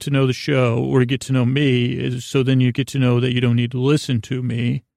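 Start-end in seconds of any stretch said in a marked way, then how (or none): none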